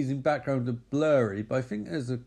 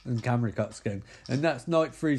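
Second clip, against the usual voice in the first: usual speaking voice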